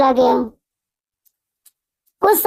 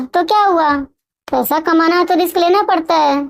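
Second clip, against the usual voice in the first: usual speaking voice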